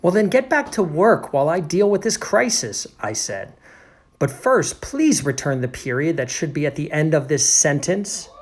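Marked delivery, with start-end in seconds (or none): 0.0-2.9 high, loud, bossy order; exasperated narrator. Acted. Narrator orders the janitor back to work, exasperated. 4.2-8.3 loud, insistent demand; exasperated narrator. Acted. Narrator demands the period back from janitor, exasperated.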